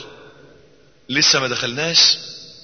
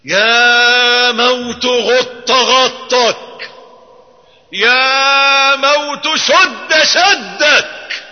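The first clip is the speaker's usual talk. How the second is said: very high, loud, drawn-out very slow wail; crying out to death; 10 semitones higher, 6 dB louder, much slower than usual. Retold or imitated. Dying Hudhayfa calling out to death, drawn-out wail. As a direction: cry out to death with all your voice. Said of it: "فكان يصيح بأعلى صوته".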